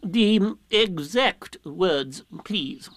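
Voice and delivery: ponderous, unyielding owl character; insistent Owl, ignoring the answer. A narrator's reading. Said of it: "as if Rabbit hadn't spoken".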